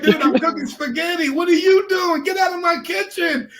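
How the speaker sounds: high-pitched mock annoyance; retold indignant retort. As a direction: argue back in playful exasperation. Retells his kitchen quarrel.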